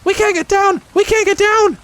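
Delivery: very high, loud excited squeal; Roo crying out. Acted. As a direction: cry out like a thrilled little creature. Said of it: "cried Roo".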